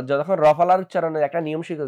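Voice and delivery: normal talking voice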